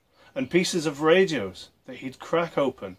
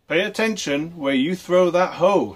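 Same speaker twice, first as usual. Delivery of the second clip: father shouting; 6 semitones higher, 6 dB louder than usual. A narrator's reading. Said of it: "his father shouted".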